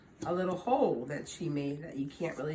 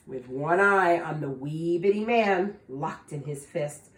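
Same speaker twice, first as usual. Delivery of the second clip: plain reading voice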